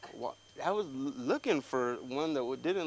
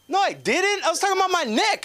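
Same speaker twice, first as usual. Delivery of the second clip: very high, loud, fast protest; high panicked denial; 7 dB louder than usual. Retold or imitated. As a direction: squeal out the excuse. Defending himself to nephew.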